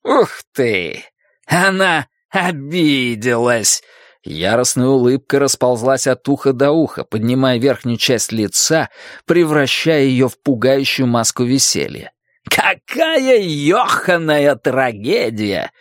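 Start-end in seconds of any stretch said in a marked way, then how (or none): 0.0-3.8 slow, jeering drawl; mock amazement at her offence. A narrator's reading. Jim jeers at her rebuke. 12.5-15.7 slow, higher mock lament; jeering fake dismay; scornful mockery. A narrator's reading. Jim mocks her hurt feelings.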